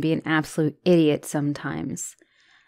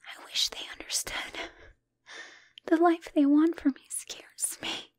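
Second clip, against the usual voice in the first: hushed unvoiced whisper; whispered fears; 5 semitones higher than usual. Girlfriend confiding her fear of the family, whispering. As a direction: let it slip out as a breathless secret.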